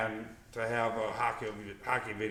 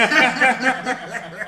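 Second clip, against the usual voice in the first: high, loud cackling laughter; 13 semitones higher, 15 dB louder than usual. Real, not acted. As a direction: burst out laughing. Laughs at co-host's reply.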